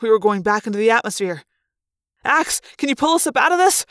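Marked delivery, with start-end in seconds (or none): 2.2-3.9 high, urgent question; raised, pressing appeal in a crisis; demanding. A narrator's reading. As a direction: demand a rescue from the pilot. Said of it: "I demanded".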